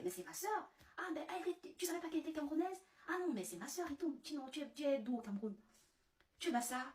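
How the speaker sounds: voix nettement plus aiguë, débit rapide, faussement enthousiaste; dragueur surexcité. Retold or imitated. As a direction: joue le compatriote ravi qui découvre une « sœur ». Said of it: "il dit aux autres collègues". (le dragueur camerounais qui fait le malin devant ses collègues, voix aiguë)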